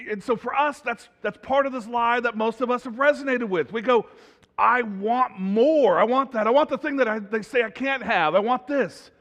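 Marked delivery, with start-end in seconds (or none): 4.6-9.1 raised, loud mock demand, first words stretched; hammed-up insistent wanting, higher and louder; greedy demand. Preacher voicing our lie that we want more, demanding.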